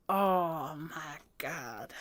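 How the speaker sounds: a real tearful whisper of awe; teary, hushed. Real, not acted. Crying after song ends.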